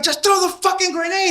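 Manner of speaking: high, loud snapping order; mock angry order. The officer yells at Sanchez to throw the grenade, high and loud. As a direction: snap at him to just do it. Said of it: "He's like".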